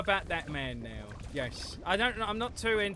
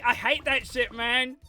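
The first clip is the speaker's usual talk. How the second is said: loud rant; 7 semitones higher, 8 dB louder than usual. Real, not acted. Ranting loudly about Daisy.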